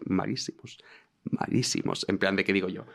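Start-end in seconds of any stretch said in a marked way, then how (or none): 1.3-2.0 soft unvoiced whispered echo. Real, not acted. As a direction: whisper the word back to yourself. Whispered echo of own word.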